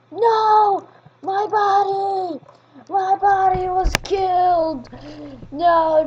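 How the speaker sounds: hammed-up grief, stretch the words; mock wail. Real, not acted. Kid mourning his dead character in the game.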